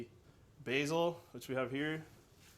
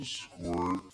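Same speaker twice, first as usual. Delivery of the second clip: deep comic squirt; 8 semitones lower than usual. Acted. Squeezing tomato paste.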